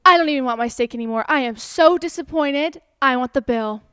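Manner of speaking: loud, fast complaining; a disappointed diner demanding the bill; angry customer. Retold or imitated. Lady with overcooked steak complaining to the server, loud and fast. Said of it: "She's like".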